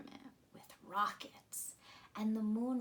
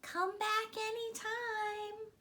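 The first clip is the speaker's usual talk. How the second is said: fond moon; 11 semitones higher than usual. A narrator's reading. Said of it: "the moon said".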